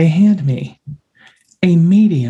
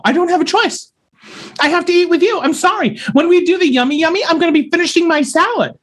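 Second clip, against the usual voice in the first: lingering meltdown; 10 semitones higher, faster than usual. Real, not acted. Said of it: "I'm having a meltdown".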